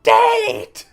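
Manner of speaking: very high, loud screech; Sokka screeching at the waterbender. Acted. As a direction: shriek the word in horror. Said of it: "screeched Sokka".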